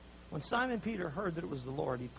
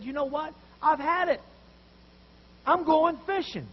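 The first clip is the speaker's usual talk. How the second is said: loud, higher, exasperated outburst; fed up; 10 semitones higher, 11 dB louder than usual. Retold or imitated. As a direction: Peter has had enough and storms off. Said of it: "And Peter was fed up".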